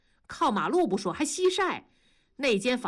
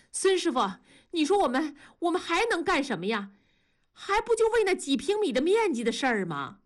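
higher-pitched aggrieved retort; indignant complaint; 8 semitones higher, faster than usual. A narrator's reading. Wife cuts in to answer the union chairman, indignant complaint. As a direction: jump in with a grievance.

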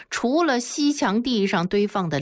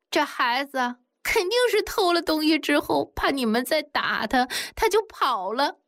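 higher, tearful pleading; crying while speaking; 8 semitones higher than usual. A narrator's reading. 小凤 explains to the men, crying and higher. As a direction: speak through tears, wronged. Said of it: "一边哭一边说".

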